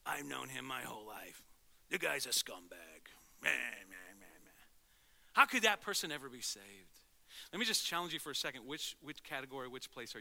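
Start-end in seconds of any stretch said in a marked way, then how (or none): 0.0-4.7 low, grumpy dismissive grumble; low, mock-disgusted grumble; grumbling crowd. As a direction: sneer like a bitter old neighbor. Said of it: "What do you see?".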